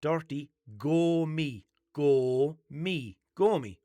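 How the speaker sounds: "Gomi" drawn out slowly, long o; correcting pronunciation. Shown as a demonstration. Correcting the AI's nickname, "Gomi" slow and emphatic. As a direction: spell out "Gomi" carefully with a long o. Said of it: "no, no".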